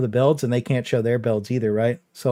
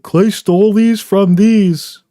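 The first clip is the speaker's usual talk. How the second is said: high, loud, slow whiny mock accusation; mocking complainer; 8 semitones higher, 7 dB louder, much slower than usual. To his viewers, mocking the build complainers, whiny. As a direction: whine it out like an accuser. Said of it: "and then they'll be like".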